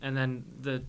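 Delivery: ordinary talk, nothing marked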